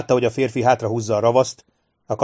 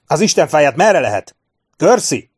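loud, high, impatient demand; urgent shout; 6 semitones higher, 7 dB louder than usual. A narrator's reading. Officer demanding the way out mid-battle, urgent shout. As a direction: yell it, ending on a higher cry. Sharp yelling urgently at the major.

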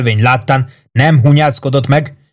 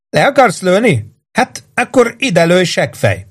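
taunting dare; 9 semitones higher, slower than usual. Acted. Starving boy taunts armed cop.

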